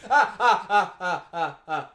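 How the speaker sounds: loud rhythmic ah, ah with laughter; laughing rhythmic cramp gasps; rhythmic pained gasps. Retold or imitated. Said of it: "I'm just like".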